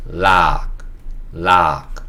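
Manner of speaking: 락 with an "ah" vowel for the o of log; demonstrated pronunciation. Shown as a demonstration. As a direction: model 락 as one drawn-out syllable, said twice. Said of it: "그 다음에 아그. 그래서 요거는". (락을 '아' 소리로 길게 시범 발음)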